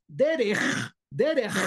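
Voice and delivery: DERECH ending in a long rasped kh; kaf sofit. Shown as a demonstration. Teacher demonstrating kaf sofit, strong scraped ending. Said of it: "se pronuncia diferente".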